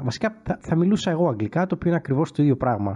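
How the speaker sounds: ordinary delivery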